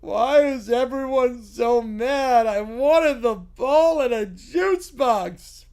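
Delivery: put-on slow, plaintive childish voice; whiny kid. Fan whining to the angry crowd. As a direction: pout through the words. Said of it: "getting just heckled".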